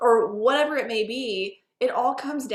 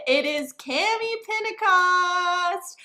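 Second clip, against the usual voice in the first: excited announcement; 6 semitones higher, much slower than usual. Real, not acted. Host announces surprise special guest.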